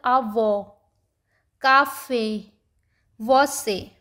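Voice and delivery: आ वो, काफे, वो से with a clear, strong last vowel; spaced-out model words आ वो, काफे, वो से, weight on the final syllable; stressed accented vowel. Shown as a demonstration. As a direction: stress and stretch the endings of आ वो, cafe, वो से. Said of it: "उसी के ऊपर stress लग रहा है".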